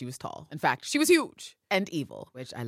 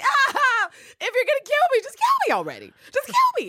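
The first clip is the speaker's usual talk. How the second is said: very high, loud anguished wail; hammed-up agonized screech; mock despair; 10 dB louder than usual. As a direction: wail it like a broken captive begging to die. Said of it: "He's like".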